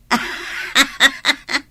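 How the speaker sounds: a short, teasing laugh; amused laugh. Acted. Friend laughing at Sara wanting blonde hair, amused laugh. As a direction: laugh at her friend's idea.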